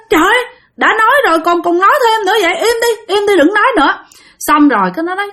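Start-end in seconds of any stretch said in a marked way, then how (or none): 0.1-3.9 high, fast scolding shout. Retold or imitated. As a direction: shush her friend sharply. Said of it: "Cái bạn mới la thêm lần nữa".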